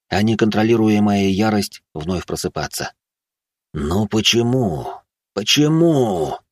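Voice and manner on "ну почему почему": slow, drawn-out agonized question; simmering rage. Acted. Enraged man asking why baron stayed away, simmering. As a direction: stretch out each why, rising on the second. Man's rage wakes again.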